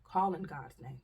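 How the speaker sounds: ordinary talk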